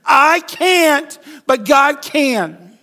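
high, loud, slow chanted declaration; congregational call. Shown as a demonstration. Preacher leading the congregation in unison, loud and high. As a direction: lead the room in it, drawing out God can. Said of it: "Can we just say that together?".

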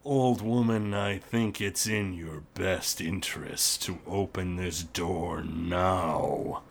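low, slow, menacing orc voice; gruff orc boss. Acted. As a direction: growl it slowly through a door. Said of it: "the gruff voice of Lockgrim".

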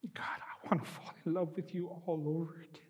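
soft, crying plea to God; tearful prayer. Real, not acted. Pastor prays through tears to God, crying softly. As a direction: let the tears break through the words. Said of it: "So I'll say it again".

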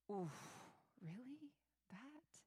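hushed, unvoiced mock recoil; doubtful whisper. Preacher imagines God reluctant at our weakness, whispering. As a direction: whisper it like a squeamish aside.

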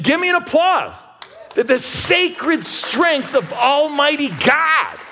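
high, loud and slowing toward the call; preaching at full force. Acted. Preacher asks the church for applause, preaching at full force. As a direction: build to a loud, high pulpit peak.

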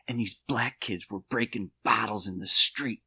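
whispered through clenched teeth; through gritted teeth. Retold or imitated. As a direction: hiss it with the jaw locked, no voice. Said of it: "I'm gonna speak through my teeth the way he speaks".

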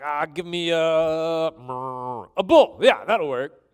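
mock indecision, drawn-out filler then a sudden pick; acted offhand choosing; willy-nilly. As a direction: hem aloud, then settle on it casually. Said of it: "this willy-nilly, like".

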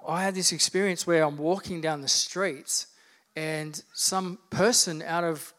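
ordinary talk

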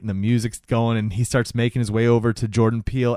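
ordinary talk, nothing marked

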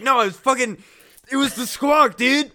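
mock-indignant man covering up his tears; defensive denial. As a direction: act caught crying and deny it hard. Voices crying man caught, denying it.

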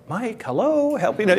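mock plea, pitched high and thin; pleading board member. Board pleading with Mike, a lightly voiced mock cry for help. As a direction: play the board begging the pastor for help. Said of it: "the board's like".

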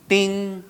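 high, sustained "ding"; imitated bell ding. Preacher marks the first target falling, imitated bell ding. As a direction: sound the bell on one ringing note.

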